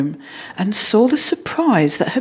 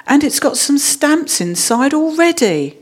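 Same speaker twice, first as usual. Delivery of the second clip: higher-pitched delighted exclamation; excited cry; 7 semitones higher than usual. A narrator's reading. Jonathan finding stamps in his new album, crying out excitedly. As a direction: lift the pitch with boyish delight. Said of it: "cried Jonathan".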